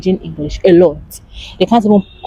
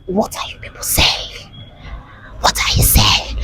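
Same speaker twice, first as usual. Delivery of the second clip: voiceless, incredulous whisper; shocked whisper; 9 semitones lower, 6 dB quieter than usual. Acted. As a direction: whisper it in mock bewilderment. Acting out shocked bewilderment.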